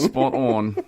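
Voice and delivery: loud, high mock New Zealand accent. Host repeats the joke to co-host, mock New Zealand accent. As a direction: ham up the Kiwi vowels. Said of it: "New Zealand people are laughing. They're like".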